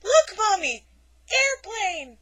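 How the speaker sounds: put-on high, loud child voice; toddler voice. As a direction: chirp like an excited little kid. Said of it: "and he says".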